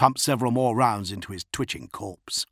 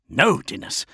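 snapped, higher-pitched refusal; snapping; 6 semitones higher, 4 dB louder than usual. A narrator's reading. Lord refusing his officer's urging, snapping. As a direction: snap it back irritably. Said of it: "Namet Jira snapped".

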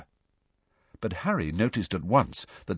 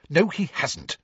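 high, curt contradiction; mildly snappish, pitched up; snapped; 8 semitones higher than usual. A narrator's reading. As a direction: snap back in denial. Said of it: "snapped Hermione".

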